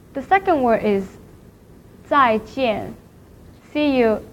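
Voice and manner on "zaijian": "zaijian" with a fall on each syllable; fourth tone. Shown as a demonstration. Teacher introduces the word for see you, falling "zaijian". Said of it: "Here are some fourth tones".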